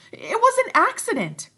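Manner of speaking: very high, loud insistence; insistent Piggy. Acted. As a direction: insist shrilly.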